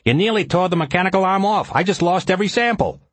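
high-pitched, heated complaint; angry yell. A narrator's reading. Ellis blaming the pilot for lost samples, angry yell. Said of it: "Ellis yelled".

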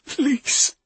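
high, trembling plea; shaky, begging voice. A narrator's reading. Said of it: "he said in a trembling voice".

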